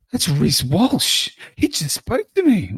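high, thrilled mock-kid voice; put-on starstruck excitement, stretched out; imitating amazed son. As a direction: play a boy who can't believe his luck. Son amazed, retold by dad.

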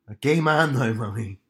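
imitated Northern Irish lad, falling away on the last word; strong Belfast accent. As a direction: announce it to mum in thick Belfast. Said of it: "I put on a strong Belfast there".

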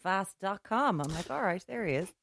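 mock weary recognition. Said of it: "I just see him going".

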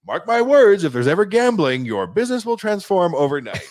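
high, loud prophetic warning; confident prediction. Retold or imitated. Imitating the Swedish CEO's bold forecast before leaving. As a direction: proclaim it like a prophecy. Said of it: "he's like".

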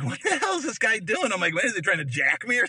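high-pitched, incredulous retelling; alarmed disbelief. Retold or imitated. Driver reacting to attendant following him, alarmed disbelief. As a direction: replay a rising what-is-going-on panic.

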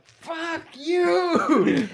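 high, strained comic outburst; hammed-up retaliation; mock defiant cry. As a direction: cry it out high, in pretend fury. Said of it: "you're like".

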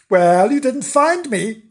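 high, smug storybook animal voice; gloating Bernard. Acted. Bernard teasing the dogs who searched, gloating.

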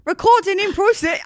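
put-on high, loud stab at an Australian accent; bad Australian accent breaking into laughter. Retrying the recording notice for co-host, giggly Australian accent. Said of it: "please do your Australian accent".

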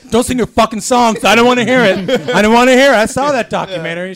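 loud, high-pitched comic yelling; hammed-up shouted refusal; mock angry rant. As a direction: yell it like an absurdly hostile host. Joke tirade at singing guest.

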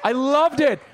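high, loud insistent burst; emphatic gushing. Real, not acted. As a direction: blurt it out with total enthusiasm. Emphatic repeat of own praise.

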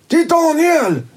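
Tony recognising Daniel through the door, high excited; excited recognition. Retold or imitated. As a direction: voice jumps way up in sudden recognition.

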